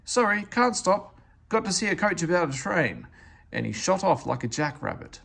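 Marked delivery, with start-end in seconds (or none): 0.0-3.1 hurried excuse. Acted. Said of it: "he went on hurriedly".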